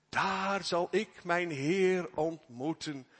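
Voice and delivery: "Daar zal ik mijn Heer ontmoeten" drawn out slowly, leaning on "Heer"; slow and savoured. Acted. Preacher savouring the longed-for meeting before the congregation, slow. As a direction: let every word of it linger. Repeats hymn line, drawn out.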